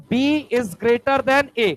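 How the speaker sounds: very slow, each word set apart; slow dictation. Shown as a demonstration. Teacher dictating the answer to students, very slowly. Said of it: "बोल सकता हूं".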